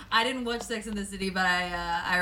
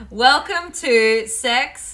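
slow, louder showy welcome; drawn-out intro; 2 semitones higher, 9 dB louder, much slower than usual. Pretend. Host opening the podcast to listeners, slow showy welcome. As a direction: stretch the welcome like a show opener.

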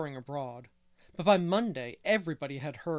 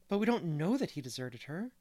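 ordinary delivery

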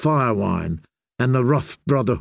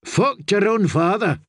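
crewman's curse; 7 semitones higher than usual. A narrator's reading. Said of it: "Sam heard one man say".

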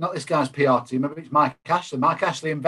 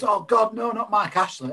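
dismayed crowd voice; 7 semitones higher than usual. Retold or imitated. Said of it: "everyone goes".

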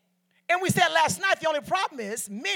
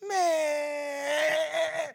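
a drawn-out, mock-mournful "Man"; imitating his brother; 2 semitones higher than usual. Preacher mimics his brother's sad, drawn-out "Man". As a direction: play the brother sighing out his one word. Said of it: "And this is how he talk".

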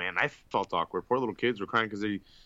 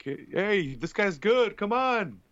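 mock cheering fan; 11 semitones higher, much slower than usual.

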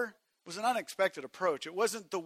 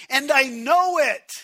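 loud; 9 semitones higher, 15 dB louder than usual. Acted. Preacher repeats line, loud then soft.

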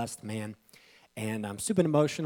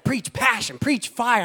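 higher, louder rallying cry; urging wife; 10 semitones higher, 7 dB louder, slower than usual. Retold or imitated. Pastor voicing wife urging him to preach with fire.

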